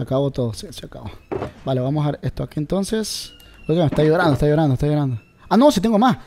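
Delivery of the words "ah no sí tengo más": surprised. Real, not acted. Finds more items unexpectedly.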